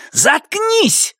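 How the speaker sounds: high shouted order; yelling. Acted. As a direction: yell it at everyone. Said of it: "заорал Руслан".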